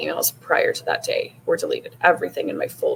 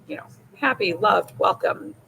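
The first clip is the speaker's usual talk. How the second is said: usual speaking voice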